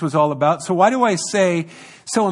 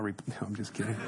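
soft chuckle through the words; quiet laughing aside; 8 semitones lower, 16 dB quieter, much faster than usual. Real, not acted. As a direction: laugh it off, much softer. Joke about phone interruption.

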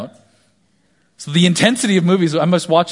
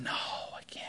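hobbit whisper; 20 dB quieter, slower than usual. Retold or imitated. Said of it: "he's going".

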